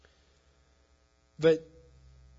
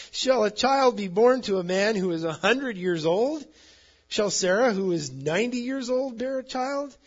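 higher and louder, climbing in disbelief; incredulous; 8 semitones higher, 7 dB louder than usual. A narrator's reading. Abraham asking himself about a son at a hundred, incredulous. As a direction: ask it as if the idea is absurd. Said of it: "and laughed, and he said to himself".

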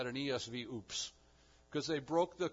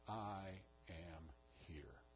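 hushed slow declaration; 8 semitones lower, 13 dB quieter, much slower than usual. Acted. God's self-declaration, hushed and slow.

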